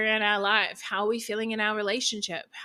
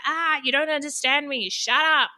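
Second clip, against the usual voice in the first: higher, louder mock snap at him; mock angry outburst; 6 semitones higher, 4 dB louder than usual. To her partner, mocking how she used to blow up angrily. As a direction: act out blowing up at him. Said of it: "me getting angry and be like".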